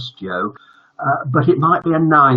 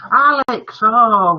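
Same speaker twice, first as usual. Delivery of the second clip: excited call; 10 semitones higher, 7 dB louder, much slower than usual. Real, not acted. Spotting a friend in the chat.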